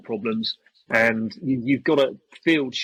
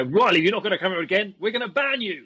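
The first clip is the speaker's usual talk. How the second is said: high-pitched imitation of a threat; mock an indignant official, pitched up; mock scolding; 7 semitones higher than usual. Field staff warning Riley of a ban, mock scolding.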